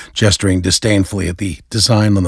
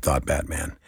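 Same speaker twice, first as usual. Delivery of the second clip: ordinary delivery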